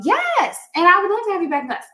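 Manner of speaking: high, fast, bright welcome back; excited welcome. Real, not acted. To a guest on her live, an excited fast welcome. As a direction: gush the invite quickly and high up.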